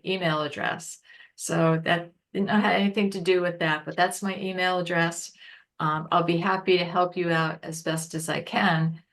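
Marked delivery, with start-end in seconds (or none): none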